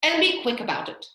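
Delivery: high, loud, impatient Hatter. Acted. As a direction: hurry them along loudly.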